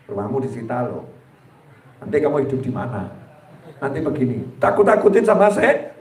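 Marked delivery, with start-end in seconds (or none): 0.1-4.5 low, soft devilish taunt; menacing whisper. Retold or imitated. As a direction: whisper the threat like a tempter. Satan frightening the debtor.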